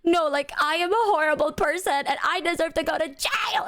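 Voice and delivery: high, whiny self-pity; lightly voiced dramatic remorse; mock despair. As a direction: ham up the guilt trip. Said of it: "And she's like".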